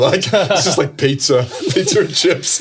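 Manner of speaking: high, loud laughter through the words; laughing retelling. Retold or imitated. Player admits his diet is pizza, retold laughing. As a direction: crack up while quoting him.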